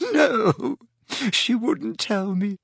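put-on a crying girl's voice breaking into sobs; tearful. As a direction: sob out the whole confession. Leanne crying.

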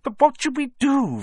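very high, loud anxious question; frightened pleading. A narrator's reading. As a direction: plead with husband for an answer. Frightened wife asks what to do.

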